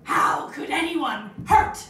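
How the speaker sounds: loud, very slow, anguished outburst; hammed-up overcome mother, drawn out; impression of mum. Mum looking down at baby's face, hammed-up loud anguish. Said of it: "she looked down in her face and she went".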